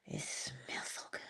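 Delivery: savoring the smell of the cake, whispered. Real, not acted. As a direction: whisper it in delight.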